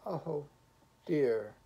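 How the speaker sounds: a touch of tearfulness; beginning to weep. A narrator's reading. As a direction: falter as if tears are starting. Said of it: "beginning to weep".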